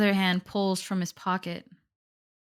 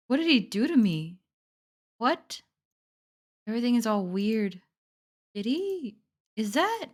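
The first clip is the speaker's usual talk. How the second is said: pitched-up bewildered questions; shocked confusion; 3 semitones higher than usual. Real, not acted. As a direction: reel in disbelief at what's happening. Player reacts in shock to the scene.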